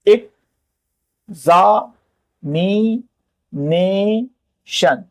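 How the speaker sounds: Examination very slowly, broken into five beats; teacher sounding out examination piece by piece; syllable by syllable. Shown as a demonstration. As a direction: stretch out each syllable of examination with pauses. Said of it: "तो यही syllable है एक".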